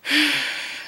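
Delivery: soft, short exhale; weary sigh. Real, not acted. Vlogger recapping a coworkers' argument, weary sigh. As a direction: let out a quiet sigh. Sighs after the retold argument.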